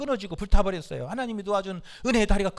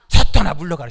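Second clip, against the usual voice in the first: loud demonstration shout; forceful rebuke; 3 semitones lower, 12 dB louder than usual. Shown as a demonstration. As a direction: show them how to shout it with force. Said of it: "가슴을 한번 이렇게". (본보기로 힘차게 외치는 명령)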